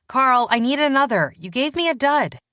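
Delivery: calling to the couch for a working pen; annoyed call. Acted. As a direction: demand a new pen, mildly exasperated. Danny calling to Carl for a pen.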